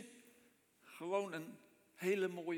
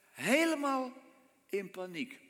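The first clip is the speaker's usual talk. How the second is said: higher, louder Helemaal; mock panic; 5 semitones higher, 4 dB louder than usual. Preacher acting out the disciples' panic in the storm. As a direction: cry it out in alarm. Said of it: "dramatizing the disciples' panic".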